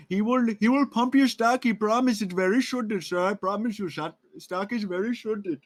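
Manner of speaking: hammed-up Indian accent, salesman pitch. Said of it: "that was the worst Indian accent I've ever heard".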